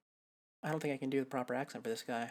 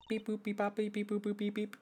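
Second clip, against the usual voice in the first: beeping singer voice; 6 semitones higher than usual. Acted. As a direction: chirp the beeps high like a song. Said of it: "That voice".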